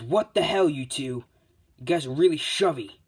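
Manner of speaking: loud, high-pitched indignant protest; acted irritation, punched up; annoyed outburst. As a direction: snap at the pair shoving you. Bakugo protests at being shoved.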